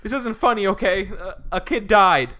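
loud, high indignant rebuke. Retold or imitated. Woman telling old man a kid died, indignant.

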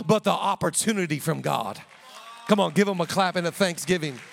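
loud, driving sermon delivery; full-force preaching. Acted. Pastor preaching to the church, full force. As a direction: preach it hard to the congregation.

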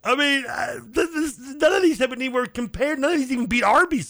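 high, loud comic protest; mock outrage. Real, not acted. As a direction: protest in a hammed-up cry. Mock outrage over food.

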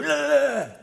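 sudden loud high grunt as if yanking a dog's ears; acted grunt. Preacher acting out grabbing a pit bull, loud grunt.

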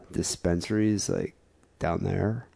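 halting mock-Californian, pausing to think; slow Californian stoner. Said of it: "'Cause they're slow".

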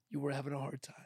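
whispered kindly; hushed sympathy for a bad day; soft whisper. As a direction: say it under your breath, gently. Calm reply to angry wife.

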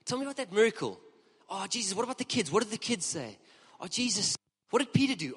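high, breathless gushing chatter; gushing friend. Retold or imitated. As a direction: gush over Jesus like an excited friend. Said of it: "I sometimes see my wife like this".

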